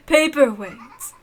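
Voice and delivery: laughing. Acted. Laughing at paperweights.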